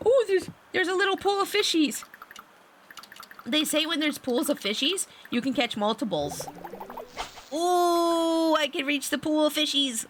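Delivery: high-pitched, delighted gasp and coo; excited discovery. Real, not acted. Player spotting a pool of fishies, excited. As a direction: squeal over a lucky find.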